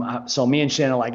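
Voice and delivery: usual speaking voice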